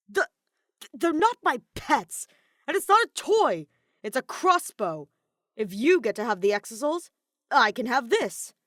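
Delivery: stammering, then loud indignant protest; flustered anger. A narrator's reading. Kaito defends his crossbow, loud and flustered. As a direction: trip on the words, then push back hard.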